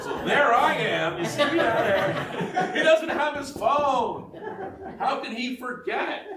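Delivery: high, loud laughing self-teasing; real laughter at herself. Real, not acted. Laughing at her own situation.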